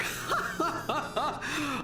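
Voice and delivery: high gloating villain laugh. Acted. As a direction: cackle over the captive.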